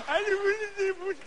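put-on high, slow whiny baby voice; squeaky baby talk after a kick. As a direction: whimper it like a hurt toddler. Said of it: "your mother think you talking baby".

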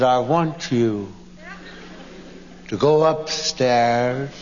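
slow, low, measured command; low, deliberate mock menace; grim wifely order. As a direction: draw out each phrase with deadly seriousness. Wife's grim order, acted.

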